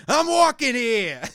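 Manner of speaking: loud, high, drawn-out New York yell; overboard New York accent. Acted. Joking about overdone New Yorkers, way overboard New York accent. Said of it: "the New York accent and, like, the way overboard".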